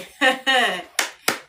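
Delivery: loud delighted laugh. Real, not acted. Streamer delighted that a viewer stayed, loud laugh. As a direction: burst out laughing.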